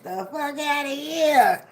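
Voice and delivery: ordinary delivery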